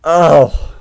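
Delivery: high, loud pleading cries; real terror, cried out; panicked. Real, not acted. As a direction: beg in real fright. Panicked reaction to scare.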